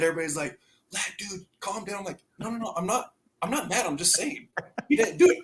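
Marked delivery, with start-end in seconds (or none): 0.9-2.0 hushed, higher aside; soft retold warning; imitated calming teammates. Teammates quietly calming him, retold. 2.4-5.4 high, softened self-defence; higher, squeaky-innocent mock denial; retold defensive protest. Retold defensive reply to teammates.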